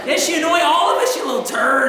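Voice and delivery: quick, high mock scolding; hammed-up parental annoyance; exasperated parent. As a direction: blurt out a parent's exasperated comeback.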